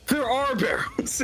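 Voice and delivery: high-pitched comic discovery; sudden squeaky amazement, hammed up; mock realization. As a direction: jump way up in pitch, as if just noticing. Game master's mock sudden discovery.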